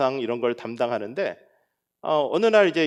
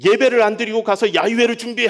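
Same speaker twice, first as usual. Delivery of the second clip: loud, higher acted reprimand; raised voice, imitated outrage; indignant scolding; 6 semitones higher, 5 dB louder than usual. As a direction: re-enact telling people off. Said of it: "막 야단도 치고 막 그랬어요".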